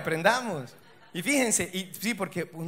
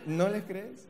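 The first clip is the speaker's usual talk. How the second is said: soft laughing; 6 dB quieter, much slower than usual. Acted. Segunda vez, suave con risa.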